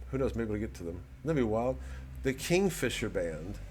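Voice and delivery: ordinary talk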